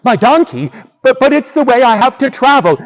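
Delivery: high, quick, stammering objection; dismayed protest. Acted. Boots is asked to give up his donkey, dismayed. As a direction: protest in startled disbelief.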